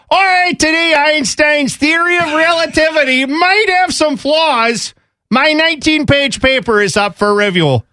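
a Badger physics lecturer in a thick Wisconsin accent; Wisconsin professor accent. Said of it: "is that how everyone talks there".

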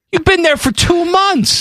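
very high-pitched incredulous protest; high squeaky disbelief; exasperated disbelief. Real, not acted. Mock outrage at friend's new accent.

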